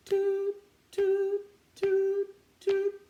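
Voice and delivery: very high, loud toot calls. Shown as a demonstration. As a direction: whistle each toot up high. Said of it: "try it like a turtle".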